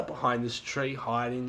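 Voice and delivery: ordinary talk, nothing marked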